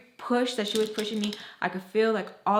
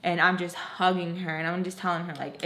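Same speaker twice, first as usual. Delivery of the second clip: ordinary talk, nothing marked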